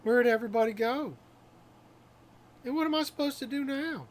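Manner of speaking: high-pitched, bewildered character voice; acted dismay at being left behind; abandoned game character. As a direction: pitch it up, lost and complaining.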